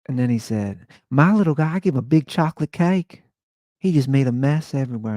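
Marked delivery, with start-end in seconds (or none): 1.1-5.1 high, loud camp Southern chatter; fond camp Southern gossip, way up high; Keith's flamboyant Southern voice. Retold or imitated. Keith telling Andy about his own dog, high camp Southern voice. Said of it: "this is how he talked".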